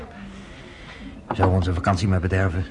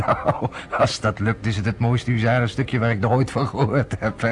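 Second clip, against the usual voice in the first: talking through a chuckle, amused; laughing. Acted. Parker to Ari about the plan, chuckling throughout. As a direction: laugh it out in disbelief.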